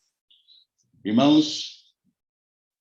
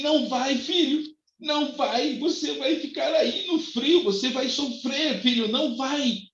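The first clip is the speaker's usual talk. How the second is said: tender pleading; 13 semitones higher, slower than usual. Retold or imitated. Jesus pleading with the sinner.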